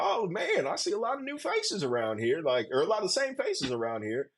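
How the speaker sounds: high-pitched mock greeting in another man's voice; imitating Dabble. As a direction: pitch it way up, imitating him sizing up the crowd. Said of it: "Dabble comes up ... he's like".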